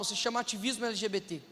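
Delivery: ordinary delivery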